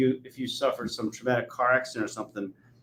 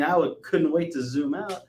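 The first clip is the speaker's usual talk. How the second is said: normal talking voice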